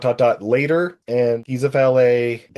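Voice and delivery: ordinary delivery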